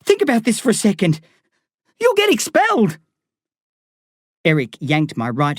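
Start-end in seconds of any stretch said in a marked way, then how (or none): 0.0-3.0 fast, high, desperate; panicked pleading. A narrator's reading. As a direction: beg him, pitch flying up.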